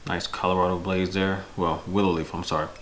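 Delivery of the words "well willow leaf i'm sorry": ordinary talk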